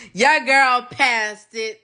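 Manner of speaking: slow and loud, savoring each word; proud announcement. Real, not acted. Telling viewers she passed, slow and proud. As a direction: announce it like a victory.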